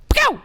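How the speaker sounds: very high sharp "pow" gunshot noise; mouth gunshot. Retold or imitated. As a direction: fire off a cartoon gunshot. Imitating marksmen shooting.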